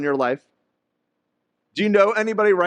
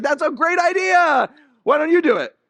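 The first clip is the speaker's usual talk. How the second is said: mock eager coworkers; 10 semitones higher, 3 dB louder than usual. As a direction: hand it back with fake enthusiasm. Coworkers in team meeting.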